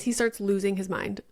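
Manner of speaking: usual speaking voice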